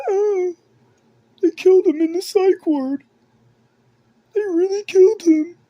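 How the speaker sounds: mocking a frightened person's crying rant; delusional crybaby. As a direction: play a babbling paranoid baby. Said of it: "Crying like a fucking delusional baby".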